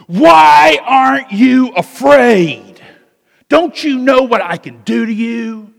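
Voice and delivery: loud, high, slow furious yell at a prisoner; angry shout. Retold or imitated. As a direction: bellow it like a threatening corrupt cop. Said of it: "and he's so angry".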